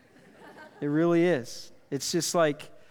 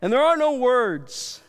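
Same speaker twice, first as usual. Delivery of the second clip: emotional; 8 semitones higher, 7 dB louder, slower than usual. Real, not acted. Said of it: "sitting there just bawling".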